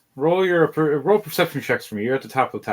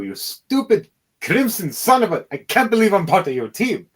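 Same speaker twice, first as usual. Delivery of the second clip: exasperated wizard; 5 semitones higher than usual. Acted. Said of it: "puts his arms down".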